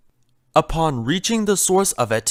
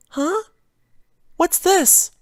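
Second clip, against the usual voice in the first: a timid pony fretting over a broken vase; Fluttershy's voice; slower than usual. Acted. Fluttershy frets to herself over the vase, higher and gentle.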